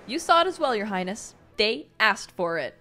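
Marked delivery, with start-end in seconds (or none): none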